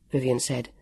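ordinary delivery

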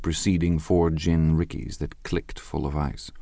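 ordinary delivery

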